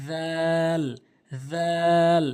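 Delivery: রাল as a long "dhaal" with voiced th; soft dh sound. Shown as a demonstration. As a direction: hold রাল, tongue tip touching the teeth. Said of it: "একদম নরম করে".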